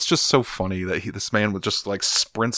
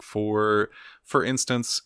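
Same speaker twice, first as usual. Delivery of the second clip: usual speaking voice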